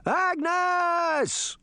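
high, long-drawn wail; alarmed cry. A narrator's reading. Old man knocked down by rabbits, crying out for Agnes. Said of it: "he cried".

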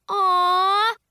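long, high, loud aww; touched little sister's loud coo; gushing aww. A narrator's reading. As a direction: gush with affection, drawn out. Little sister hugs them, touched.

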